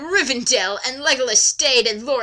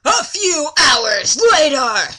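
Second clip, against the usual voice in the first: mock announcer; 5 dB louder, slower than usual. Announcing time skip.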